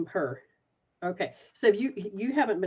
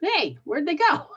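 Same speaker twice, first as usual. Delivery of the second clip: normal talking voice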